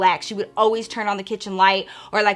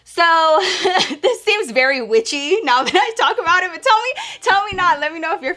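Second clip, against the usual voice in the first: laughing; 8 semitones higher, 5 dB louder than usual. Real, not acted. Laughing at witchy ritual.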